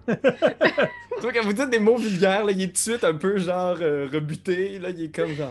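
high, loud, laughing through it; can barely speak for laughing. Real, not acted. Player describing Edgar's shock, laughing loudly.

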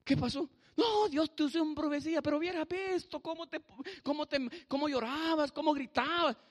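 high-pitched animated onlookers; excited, high-voiced quote; excited retelling. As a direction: gush it high, amazed at what happened. Retold excited onlookers reporting prophecy.

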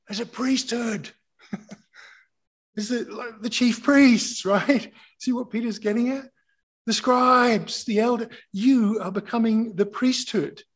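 loud, high-pitched sermon delivery; impassioned preaching. Real, not acted. Preacher explaining Peter to the church, fired up and loud. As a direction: preach it at full force, pressing the congregation with questions.

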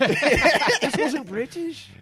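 loud, high, drawn-out fake British; attempted British accent. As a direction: overdo a bad British accent slowly. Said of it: "That might be closer".

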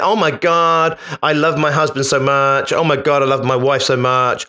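imitated American gushing; mock American oh-my-God voice; American accent. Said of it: "doing the American accent".

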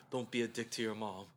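low, hushed self-reminder; soft, restrained self-scolding; calming self-talk. Acted. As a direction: talk yourself down under your breath. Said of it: "stop and breathe sometimes and be like".

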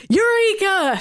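high, loud triumphant cry; screamed. Acted. Sinister finding the mainframe, triumphant scream. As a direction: shout it at the top of the lungs. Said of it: "screamed at the top of his lungs".

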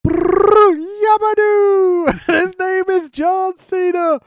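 loud, high crowd chant, stretched out slowly; crowd imitation. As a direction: bellow it like a booing arena.

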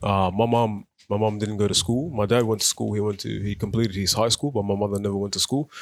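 ordinary delivery

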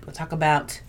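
usual speaking voice

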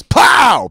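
shouted high pow like a slap; slap sound effect. Acted. The narrator voices the slap on Pete's ass, loud shout.

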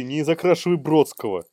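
ordinary delivery

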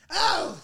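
high, loud mock scream; imitated scream. Imitating the lady screaming at us with her dog, high loud yell. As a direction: let out a sudden high-pitched yelp. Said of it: "Like, screaming at us".

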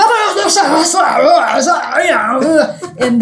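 loud mock German gibberish; old men answering in hammed-up fake German; mock harsh German. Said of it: "And they go".

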